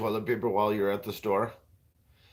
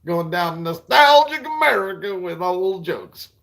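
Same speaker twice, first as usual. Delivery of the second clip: hammed-up show title, higher and louder; mock announcer; 5 semitones higher, 7 dB louder than usual. Introducing his own old joke as a TV segment, mock announcer.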